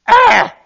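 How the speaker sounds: piercing high yelp for help; desperate cry. Shown as a demonstration. Drowning swimmer crying to the lifeguard, a sharp desperate yelp. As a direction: shriek like you're going under. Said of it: "that's all it takes".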